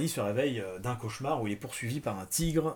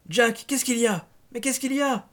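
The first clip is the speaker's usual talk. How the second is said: high, loud panicked questions; frightened wife calling to her husband; alarmed questioning; 12 semitones higher, 6 dB louder than usual. A narrator's reading. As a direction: demand answers, mounting in pitch. Mother alarmed in acted scene.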